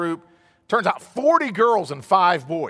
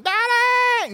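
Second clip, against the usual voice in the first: put-on high, bright call of "Daddy!" from a child running in; little girl's cry. Preacher imitating his little daughter running to him, calling out.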